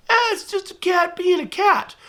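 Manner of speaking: mock-casual cat owner shrugging it off; dismissive mock shrug. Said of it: "culturally we've just said".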